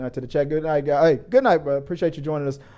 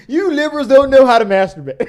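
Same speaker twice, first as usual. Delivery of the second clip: high, loud, laughing taunt; laughing mock jab; 11 semitones higher, 9 dB louder than usual. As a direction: tease them through laughter.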